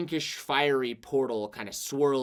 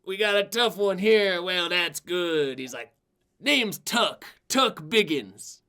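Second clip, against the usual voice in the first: put-on higher, hearty old braggart; boastful old adventurer; 5 semitones higher, 6 dB louder, slower than usual. Retired adventurer sizing up his new partner, hearty. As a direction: size up the pipsqueak with a chuckle. Tuck introducing himself.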